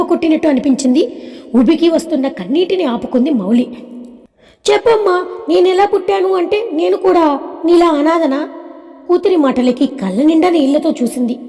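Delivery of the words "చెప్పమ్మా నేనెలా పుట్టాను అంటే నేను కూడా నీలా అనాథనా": put-on high-pitched child voice, pleading for an answer; insistent little girl. Daughter asking mother if she's an orphan too, insistent. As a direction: press the question in a small high voice.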